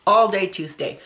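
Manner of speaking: usual speaking voice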